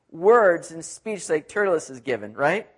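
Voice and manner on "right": usual speaking voice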